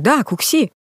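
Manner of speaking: ordinary talk, nothing marked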